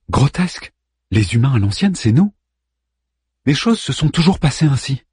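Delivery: high, loud indignant outburst; outraged. Acted. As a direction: scoff it away in outrage. Ada outraged at Arman.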